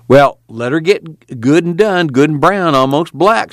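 put-on a lightly irritated old man, drawn out; irritably. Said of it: "said Muley irritably".

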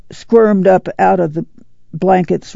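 usual speaking voice

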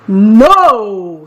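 storybook goat standing firm; firm refusal. A narrator's reading. Big goat refuses the troll, firm and loud.

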